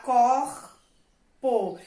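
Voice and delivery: "corpo" as COR, pause, PO, with throaty R; R sounded, syllables split. Shown as a demonstration. Teacher reading the new word, R sounded and syllables split. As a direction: stretch the R in "corpo", then add PO. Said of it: "Vou falar".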